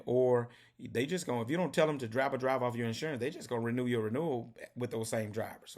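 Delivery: ordinary talk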